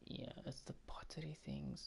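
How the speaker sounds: soft defeated whisper; resigned whisper. Real, not acted. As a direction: breathe it out in resignation. Resigned after the outburst.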